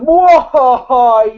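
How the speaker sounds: slow, loud hammy villain cackle; evil laugh. Retold or imitated. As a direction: mwahaha like a cartoon bad guy. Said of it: "he's cartoonishly evil".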